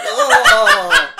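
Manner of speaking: loud high wobbling cry; shaken-up wail. Retold or imitated. Retelling a shaky first coaster ride, mock wail. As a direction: imitate yelling while being rattled about. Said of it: "shaking up like a storm".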